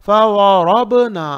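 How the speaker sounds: रा said full and heavy in फ वा रब ना. Shown as a demonstration. Quran teacher reciting for pupils, heavy रा. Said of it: "रा को भी मोटा पढ़ना है".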